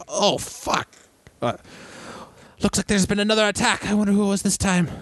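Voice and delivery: high-pitched voice of an onlooker; townsfolk reaction. Acted. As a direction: pitch it up as the startled crowd. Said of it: "Everybody, like, looks around like".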